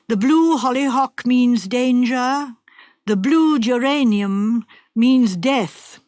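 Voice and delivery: slow, portentous reading of a warning; ominous letter. Acted. The wife's ominous letter read slowly and darkly. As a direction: read it out like a doom-laden prophecy.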